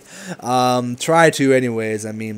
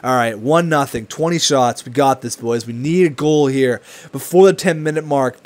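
ordinary talk

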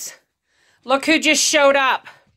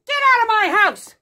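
panicked shout; 11 semitones higher, faster than usual. Real, not acted. Panicked yelling at intruder.

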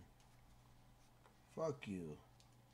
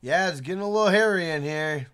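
louder, playful shout to the crew; loud banter; 3 semitones higher, 11 dB louder than usual. Real, not acted. Streamer joking to friends over voice chat, loud. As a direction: call it out with a grin.

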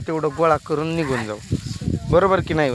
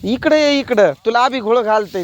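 calling out; 4 semitones higher, 5 dB louder than usual. Real, not acted. Calling a child away from the sun, loud raised call.

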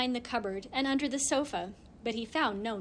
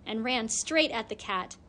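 ordinary delivery, nothing marked

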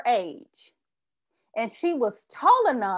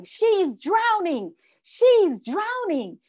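slow, higher mock yell of alarm; friends yelling; 8 semitones higher, much slower than usual. Friends at the pool calling for help, a slow high mock yell. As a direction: call out the alarm, stretched and high. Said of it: "they're yelling".

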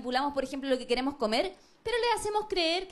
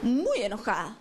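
lower, drawn-out mock sulk on "muy enojada"; hammed-up anger; 6 semitones lower, slower than usual. To her boyfriend, milking her anger in a low pout. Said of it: "Entonces voy y le digo".

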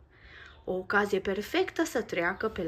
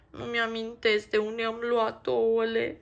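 a mildly louder worried whine; whining; 3 semitones higher, 6 dB louder than usual. Acted. Max frets to Nico he forgot where the eggs were, mild whine. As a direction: fret like a lost little bird. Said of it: "se văicărește el".

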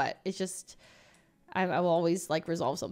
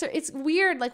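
usual speaking voice

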